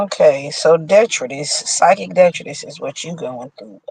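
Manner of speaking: usual speaking voice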